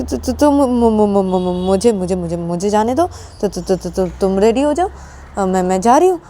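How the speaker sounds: nervous, stuttering plea to leave; acted tongue-tied fluster; flustered stammer. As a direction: get stuck on each first syllable.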